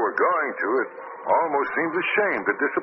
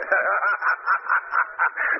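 gloating laugh; 7 semitones higher than usual. Acted. Villain revealed gloats.

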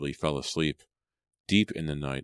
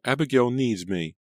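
high pleading mother's voice; frantic mother; 8 semitones higher, 3 dB louder than usual. A narrator's reading. Mother desperate to reach her daughter, high frantic plea. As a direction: beg to go to her child.

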